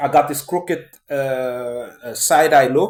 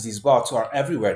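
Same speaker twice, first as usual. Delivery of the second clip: normal talking voice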